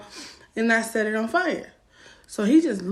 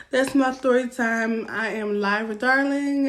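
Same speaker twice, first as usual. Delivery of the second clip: ordinary talk, nothing marked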